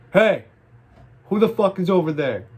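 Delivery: loud, high-pitched challenging shout; neighbor yelling. Retold or imitated. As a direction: yell it like a suspicious neighbor into the dark. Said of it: "the next thing she yells is".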